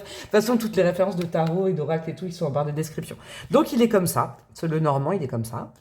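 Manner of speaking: ordinary delivery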